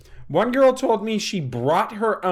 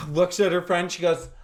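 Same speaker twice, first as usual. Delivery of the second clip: ordinary talk, nothing marked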